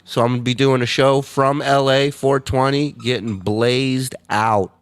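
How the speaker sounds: usual speaking voice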